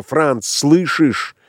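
high, drawn-out impatient call; крик. A narrator's reading. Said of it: "крикнула она". (протяжный высокий окрик)

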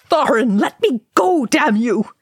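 high, loud anguished howl. A narrator's reading. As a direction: fight and howl at him. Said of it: "Balin howled".

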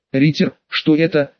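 usual speaking voice

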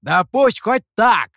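loud, high, slowed yell; emphatic high-pitched shout. A narrator's reading. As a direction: holler it, stretching the words. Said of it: "снова закричал Михоу".

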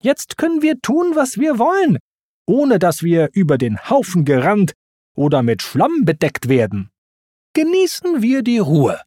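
high-pitched, emphatic argument; Timon's scolding insistence. A narrator's reading. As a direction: insist testily that all is fine. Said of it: "schimpfte Timon".

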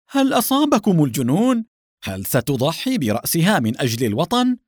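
high, loud incredulous questions; astonished shout. A narrator's reading. Agent shouting at hostages who choose homeland, astonished. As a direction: cry out in disbelief. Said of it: "اتسعت عينا العميل دهشة وصاح".

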